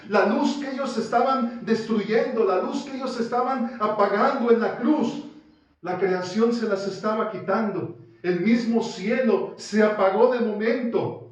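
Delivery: loud, high, impassioned sermon voice; thundering pulpit delivery; fervent preaching. Acted. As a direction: preach it out with rising passion. Preacher building to full force.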